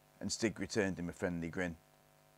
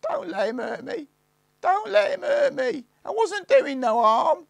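very high, loud, slower pleading; pitiful wail; 11 dB louder than usual. A narrator's reading. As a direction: wail for help, begging.

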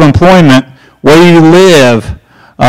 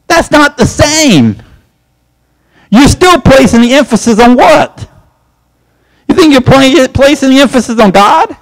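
higher, insistent pushback; emphatic rebuttal; 9 semitones higher than usual. Real, not acted. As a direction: argue the point hard, slowing on the first line. Preacher rebuts the excuse heatedly.

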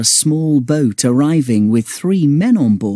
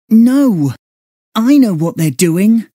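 loud, high angry outburst; 10 semitones higher, 4 dB louder than usual. Acted. Girl realising the men are up to no good, angry and loud. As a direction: snap it out in outrage. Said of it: "said April angrily".

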